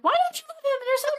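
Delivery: very high, squeaky pleading voice; mock-wounded high whine; high-pitched mock protest. Acting out a cat owner's protest.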